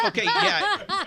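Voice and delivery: laughing out an okay, high and loud. Real, not acted. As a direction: laugh it off while agreeing. Host joke, guest laughs.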